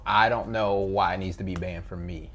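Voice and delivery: ordinary talk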